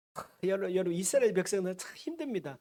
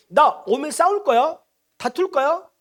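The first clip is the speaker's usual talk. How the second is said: higher, louder confrontational questions; challenging king; 6 semitones higher, 7 dB louder than usual. Acted. Preacher voicing Edom's king to Israel, challenging. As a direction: throw out a king's challenge, raised voice. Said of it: "다시 말해, 에돔 왕이".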